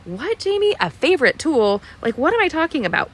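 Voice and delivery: high, quick puzzled voice of a viewer; mock viewer puzzlement. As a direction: play the confused viewer, going up high. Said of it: "I know you're thinking".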